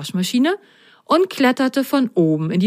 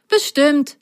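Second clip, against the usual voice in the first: higher-pitched, assured little character; confident; 8 semitones higher than usual. A narrator's reading. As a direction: answer with bright certainty. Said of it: "erwiderte Milo sicher".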